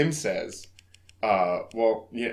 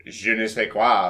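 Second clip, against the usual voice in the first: ordinary talk, nothing marked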